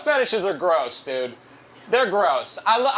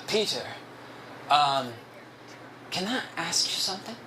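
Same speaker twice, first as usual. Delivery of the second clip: soft, hesitant coworker impression; hushed imitation of an awkward coworker; Ricardo; 7 dB quieter than usual. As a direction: put on his halting voice, quietly. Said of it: "I'm gonna do his voice".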